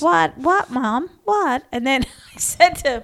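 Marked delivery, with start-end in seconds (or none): 0.0-1.6 put-on high, puzzled little boy asking what; mock innocent kid. Son playing innocent to his mum, high and puzzled. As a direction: play dumb like a child caught out. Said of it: "It's like".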